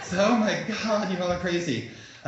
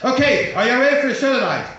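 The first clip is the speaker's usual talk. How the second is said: loud, rising hype for the show; hyping the crowd; 4 semitones higher, 7 dB louder, faster than usual. Real, not acted. Host to the audience before the comics, loud hype. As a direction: pump up the audience, fast and loud.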